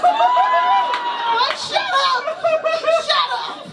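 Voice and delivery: high mock shriek of protest. Comedian reliving catcallers, high mock shriek. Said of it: "her reaction".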